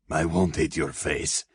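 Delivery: measured answer with a touch of heavy breath; breathing deeply. A narrator's reading. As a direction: answer evenly, slightly winded. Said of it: "breathing deeply".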